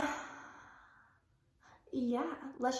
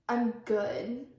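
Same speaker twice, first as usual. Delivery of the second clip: ordinary talk, nothing marked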